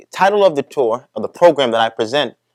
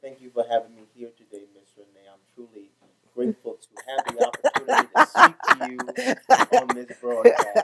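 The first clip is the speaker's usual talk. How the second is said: rehearsed formal; 3 dB quieter than usual. Acted. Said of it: "That was very well rehearsed".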